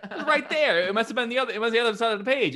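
high, loud mock amazement; astonished host. Show host reacting to the right page number, astonished high exclaiming. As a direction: blurt it out, imitating a floored host.

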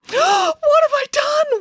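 loud, very high anguished wail; distraught high cry. A narrator's reading. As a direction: wail in horror, drawing it out. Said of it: "wailed Matilda".

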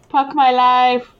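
put-on Hindi accent, "Puck" for "Fuck"; slow Indian English "Puck my life"; north indian accent. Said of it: "with a Hindi accent".

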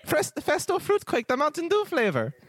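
high, bubbly elf voice; cheery high-pitched elf innkeeper welcome; jolly elf Festo. Acted. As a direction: greet them like the jolliest elf alive.

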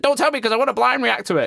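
high excited mock quote; parroting her eager request; imitating excited cohost. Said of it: "and then you were like".

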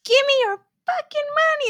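high-pitched put-on character voice; high mock demand. Player repeats a friend's video quote, high mock demand. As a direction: squeal out a comic demand.

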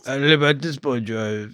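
soft, slurred mock action-star mumble; Vin Diesel impersonation. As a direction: mumble it like a movie tough guy. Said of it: "That is my Vin Diesel impersonation".

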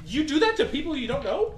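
high, loud, affronted question; outraged disbelief; indignant. Acted. Caged character confronts captors.

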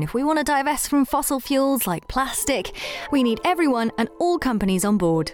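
upbeat host's announcement to listeners; podcast interlude read. Acted. Host reminding listeners about a handy form, upbeat announcer read.

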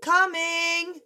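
put-on high childlike call back; high girl answer. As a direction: chirp back from the tree. Young sister answering.

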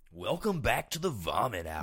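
big hammed-up intro voice; show host intro. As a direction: announce it like a radio show opener. Mock show opening intro.

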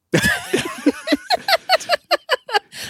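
real high-pitched burst of laughter; squealing laugh at the joke. Real, not acted. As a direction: cackle up high, falsetto.

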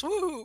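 high-pitched teasing "woo"; high, drawn-out crowd coo; mock crowd "woo". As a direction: imitate a roomful going "woo". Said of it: "Everybody goes".

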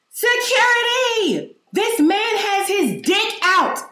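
slow, high screamed alarm, over and over; high-pitched yelling. Retold or imitated. Shopper alerting the whole store about a man, high-pitched yelling. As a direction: yell it like a fire alarm, stretched and high. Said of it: "that's how it was repeated".

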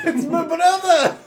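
slow, high mock character voice; mimicking Tom. Player echoing Tom's brother line, high mocking drawl. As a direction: copy the silly high voice, drawn out, laughing.

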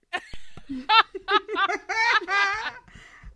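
a burst of loud laughter. Real, not acted. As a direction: laugh hard after the rant. Laughs after finishing her rant.

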